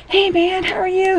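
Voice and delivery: high, cheery cartoon greeting; happy little characters. Renamed smileys meet while playing, high cheery greeting. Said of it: "having a wonderful time".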